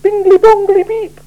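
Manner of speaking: tiny chirping device voice; small bright voice. Acted. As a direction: squeak it like a tiny machine. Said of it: "said a small bright voice".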